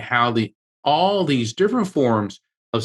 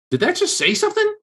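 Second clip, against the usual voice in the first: high, quick startled question; astonished question; faster than usual. Retold or imitated. Bystander at the spirit box asks the manager, astonished, high and quick.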